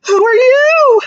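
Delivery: teacher voicing Joey meeting a bee, high and slow; curious little joey. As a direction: ask it wide-eyed like a tiny kangaroo. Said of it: "he says".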